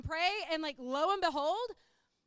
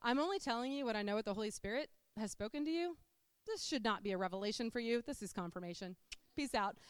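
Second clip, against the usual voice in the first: lower, quicker, matter-of-fact husband voice; Ethan, cool and unexcitable; 4 semitones lower, 3 dB quieter, faster than usual. Retold or imitated. Husband confirming the Spirit's word to wife, cool and low. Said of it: "in true Ethan fashion".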